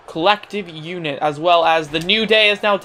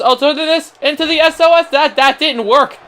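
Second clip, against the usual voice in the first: excited yelling; 8 semitones higher, 5 dB louder than usual. Real, not acted. Big move goes wrong live.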